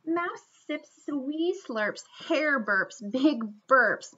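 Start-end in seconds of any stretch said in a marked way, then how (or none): none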